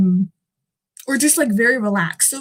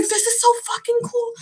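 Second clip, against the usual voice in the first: excited squeal; 10 semitones higher, 4 dB quieter than usual. Retold or imitated. Quoting her excitement at the session.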